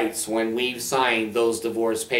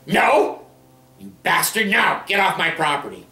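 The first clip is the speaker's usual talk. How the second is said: angry shouting ex-wife; 5 semitones higher than usual. Retold or imitated. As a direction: chase him off the property, shouting. Ex-wife yelling at ex-husband.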